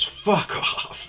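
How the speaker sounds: high, shouted retold exclamation; mock disbelief. Professor learning the essay was bullshit, shouted disbelief. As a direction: imitate an astonished man yelling it.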